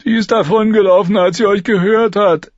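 put-on a scared boy reporting; Lommi. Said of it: "berichtete Lommi".

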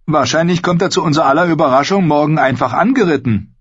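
hohe, rasche Jungenstimme; hoffnungsvoller Junge. A narrator's reading. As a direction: muntere den Freund auf, hoch und schnell. Pip macht Hoffnung. (hoffnungsvoll, hoch und rasch)

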